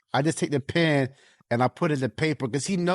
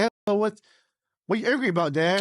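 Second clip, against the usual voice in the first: put-on much higher, curious kid's question; imitating his son; 9 semitones higher, 3 dB louder, faster than usual. Son asking his upset dad what's wrong, lightly voiced. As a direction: play the little boy checking on his dad. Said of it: "He's like".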